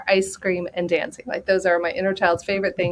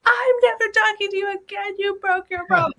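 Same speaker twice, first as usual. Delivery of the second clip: put-on much higher, wounded child voice; a little girl angry at a broken promise; sulking little girl; 13 semitones higher than usual. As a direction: whine like a four-year-old let down. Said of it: "She gives the princess a look".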